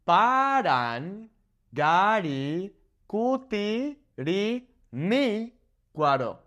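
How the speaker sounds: very slow, syllable by syllable; slow teaching breakdown; broken into syllables. Shown as a demonstration. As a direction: spell out Parangaricutirimícuaro slowly, one syllable at a time. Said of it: "let's break it down".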